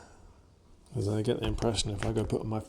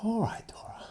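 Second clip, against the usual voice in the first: whisper; 12 semitones higher than usual. Real, not acted. Soft call to the dog.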